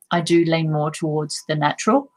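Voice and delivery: ordinary talk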